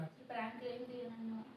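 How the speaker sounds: soft, subdued and hurt after crying; hurt wife quietly questioning her husband; quiet teary. Real, not acted. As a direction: sniffle through the words. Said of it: "ఏడుస్తూ ఉంది".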